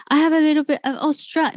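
attempted Australian accent. Shown as a demonstration. Host showing off to Australian guest, attempted Australian accent. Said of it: "I love doing my Australian".

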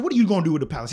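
loud, exasperated demand; snapping. Retold or imitated. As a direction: fire the question at him loudly. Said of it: "I just snapped".

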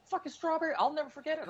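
high-pitched outraged rant. Retold or imitated. Ranting about buying a cake.

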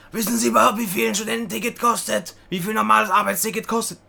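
high, loud mock scolding; indignant clerk. Clerk lecturing trainee on ticket prices, high indignant voice. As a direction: mimic a clerk berating someone. Acted clerk berating the applicant.

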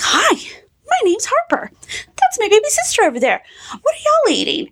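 put-on high chirpy little girl voice; imitating little girl. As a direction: chirp it like a three-year-old. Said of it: "she was like".

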